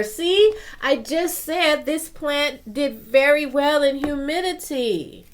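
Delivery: loud, high burst of told-you-so; real high-pitched outburst of excitement; excited vindication. Real, not acted. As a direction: exclaim it loudly, proved right by the book. Book confirms what she just said.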